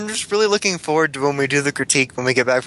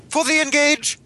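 mock cheer; 12 semitones higher, slower than usual.

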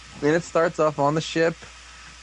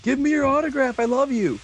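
much higher starstruck gush; gushing fanboy; 11 semitones higher than usual. Retold or imitated. Recapping, the fan begging his idol, high gushing voice. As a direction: squeal it at your hero.

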